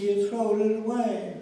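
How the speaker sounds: put-on little boy whimpering that his toy was thrown away; imitating a crying little boy. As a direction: lightly voice the upset child. Crying son voiced in story.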